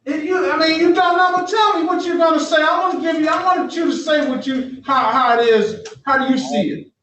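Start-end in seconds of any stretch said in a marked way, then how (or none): 0.0-3.9 raised scolding voice. Real, not acted. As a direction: press him hard, almost shouting. Judge scolding defendant in court.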